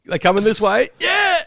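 a diner squealing as food arrives; hammed-up delight, Yeah pitched very high; excited.

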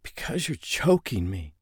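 strained, choked rasp. A narrator's reading. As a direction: push the words out against a grip on the throat. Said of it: "she rasped".

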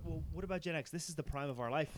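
ordinary talk, nothing marked

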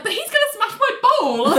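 very high, laughing wail of protest; mock upset whine. Retelling John protesting over his bowl, comic high wail. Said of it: "he's really upset".

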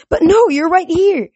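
high, loud protest; distressed cry. A narrator's reading. Satyr protesting to his fading god, distressed cry. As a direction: cry it out in disbelief. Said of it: "Grover cried".

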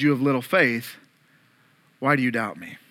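low, soft "You have little faith" spoken tenderly; gentle rebuke. Acted. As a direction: correct him gently, close and quiet. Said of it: "Jesus reaches out and grabs him and says".

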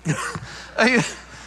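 breathy airy laugh at his own joke; a quick amused chuckle. Real, not acted.

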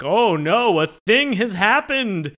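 loud, high mock-dramatic cry; mock alarm. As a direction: announce it slowly with hammed-up shock. Mocking the show's plot twists.

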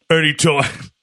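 put-on big wrestler voice; Braun. Host quoting a wrestler's comment, wrestler impression. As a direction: do a wrestler's interview voice. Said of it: "do this in my Braun voice".

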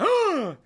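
loud, high mock gasp; shocked gasp. Host mimics the scandalised onlookers, shocked gasp.

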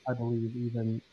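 muffled mic. Real, not acted. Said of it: "you sound muffled".

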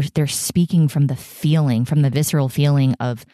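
ordinary talk

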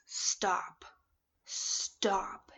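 a calm inner voice halting her. Retold or imitated. The inner voice telling her to stop, hushed. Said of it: "It's like a voice just says".

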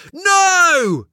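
loud, high desperate cry; shout. Acted. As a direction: scream no with everything you have. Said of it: "Jackson shouts".

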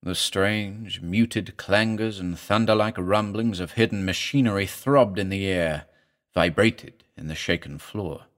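ordinary delivery, nothing marked

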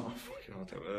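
muttered whisper. Retold or imitated. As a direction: grumble it under your breath. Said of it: "and he was like".

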